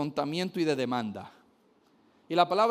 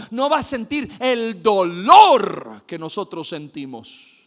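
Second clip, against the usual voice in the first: loud, high, impassioned sermon; rousing pulpit preaching; preaching at full force; 5 semitones higher, 7 dB louder, slower than usual. Acted. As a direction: build the fervor phrase by phrase. Fervent preaching build.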